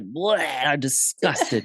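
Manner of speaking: drawn-out "bleh" of a disgusted horse; slow, hammed-up revulsion; mock disgust. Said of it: "shook his head and he was like".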